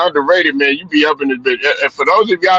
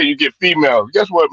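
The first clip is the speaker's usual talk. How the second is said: ordinary talk, nothing marked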